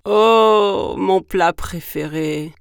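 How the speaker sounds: loud, slow monster growl; big booming beast voice; growling gruffalo. Acted. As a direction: growl hungrily at your favourite meal. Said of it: "grogna le gruffalo".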